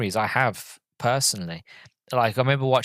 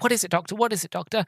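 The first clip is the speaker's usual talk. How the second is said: put-on high, eager child voice; eager kids; 9 semitones higher, faster than usual. Kids asking the actor playing the Doctor, in eager high voices. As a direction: ask like a wide-eyed kid companion. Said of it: "he's like".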